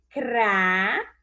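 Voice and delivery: "cra" drawn out as a lone syllable; sílaba separada. Shown as a demonstration. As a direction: stretch "cra" slowly, cut off from the rest. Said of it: "eu vou separar assim". ("cra" como sílaba isolada e alongada)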